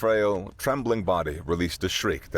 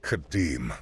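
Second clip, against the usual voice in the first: usual speaking voice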